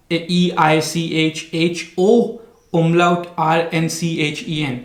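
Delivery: slow, letter-by-letter spelling. Shown as a demonstration. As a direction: spell it aloud with each letter set apart. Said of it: "आप इसकी आप spelling देखें".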